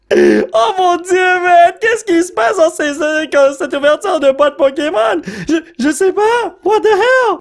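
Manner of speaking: very high, drawn-out amazed wail; incredulous squeal. Real, not acted. Card opener stunned by one lucky box, incredulous squeal. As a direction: cry out in giddy disbelief. Disbelief at repeated lucky pulls.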